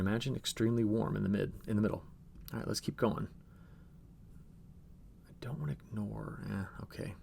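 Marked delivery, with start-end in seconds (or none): 5.3-7.1 whispered aside under the breath; a whispered half-thought to himself. Real, not acted. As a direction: drop to a quick unvoiced whisper.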